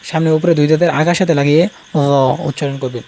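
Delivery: normal talking voice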